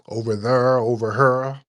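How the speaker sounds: St. Louis "thurr" and "hurr" vowels; St. Louis accent. Shown as a demonstration. Host imitating places he has visited, St. Louis accent. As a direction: lean on the r, St. Louis style. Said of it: "those accents are very distinct".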